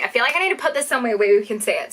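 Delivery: normal talking voice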